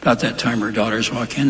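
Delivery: ordinary talk, nothing marked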